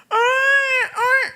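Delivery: high-pitched mock wail; two squealed infant cries; imitated baby crying. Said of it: "Anak nangis".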